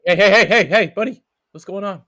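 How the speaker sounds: loud, high "hey hey" calling him out; loud protest. Real, not acted. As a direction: shout him down, half joking. Playful protest at teammate answering.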